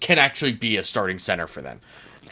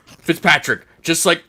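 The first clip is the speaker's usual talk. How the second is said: loud, higher-pitched pleading at a player; exasperated plea; 4 semitones higher, 6 dB louder than usual. Acted. Fan pleading with the quarterback to adjust, loud and exasperated. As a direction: yell advice at the TV, exasperated.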